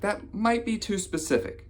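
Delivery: high-pitched hesitant objection; comic high voice of a sceptical pal; mock doubtful friend. Acted friend doubts the stereotype.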